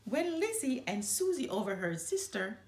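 ordinary delivery

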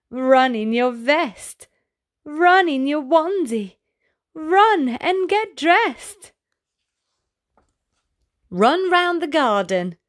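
ordinary delivery, nothing marked

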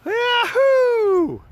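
high joyful whoop; excited cheer. Real, not acted. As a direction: cheer them down the hill. Cheering kid sledding down.

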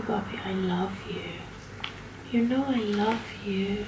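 saying goodbye to the dog, soft tender murmur; tender hush. Real, not acted. Cuddling the dog softly.

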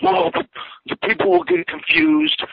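squeaky high mock of the boss. Mocking the boss who banned his move, high squeak. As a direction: make him sound silly and whiny.

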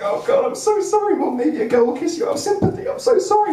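very high, panicky sorry; frantic apology. Retold or imitated. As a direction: squeak out the apologies. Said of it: "I was like".

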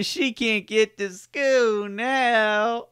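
put-on slow and high, hamming the southern accent; mock Louisiana drawl. Host parodies the witness's Louisiana line, mock drawl, high and slow. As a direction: draw out every word like the Louisiana witness. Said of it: "That doesn't even sound genuine".

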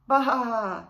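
loud crying baa; hurt bleat. A narrator's reading. Lamb crying out as a branch scrapes his leg. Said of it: "he cried".

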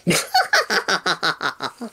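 high, rising cackle of a creepy clown; clown laugh. Shown as a demonstration. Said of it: "this is how I laugh, uh, of a clown".

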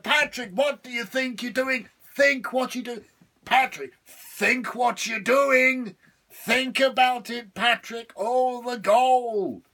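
high, loud, pleading devil; frantic devil. Acted. Trapped devil frantically pleading with Patrick. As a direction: beg desperately, dragging it out. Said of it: "the devil's going frantic inside".

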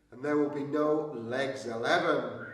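ordinary talk

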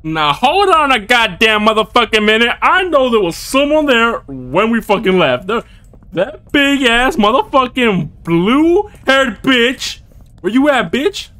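loud, high-pitched angry shouting; furious yell. Real, not acted. Gamer yelling at the unseen monster, furious and loud. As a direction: yell at the monster hiding in the dark.

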